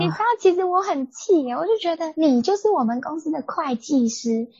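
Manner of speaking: high, heated complaint; venting real anger, pitch up; angry. Real, not acted. Telling a friend about the aunt, heated and high.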